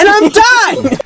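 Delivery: slow, very high mock meltdown; going crazy. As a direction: screech each word out in hammed-up exasperation. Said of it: "he went that crazy in this situation".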